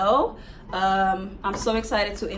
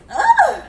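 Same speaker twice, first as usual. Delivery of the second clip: sudden high, loud squeal; real squeal of delight at a reveal; excited scream; 13 dB louder than usual. Real, not acted. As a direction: shriek with excitement. Screams on seeing the wig.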